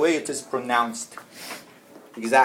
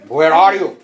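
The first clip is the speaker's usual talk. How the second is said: louder, pushed 'Where are you?'; louder, sharper version of 'Where are you?'; harsher, louder take; 2 semitones higher, 10 dB louder than usual. Shown as a demonstration. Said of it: "you can tone your voice".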